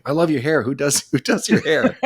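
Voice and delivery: high, slow, laughing gushing voice; mock Evazan subtitle voice. Retelling the subtitled cantina scene, high slow laughing gush. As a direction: gush over his hair, half laughing. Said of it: "he's just saying".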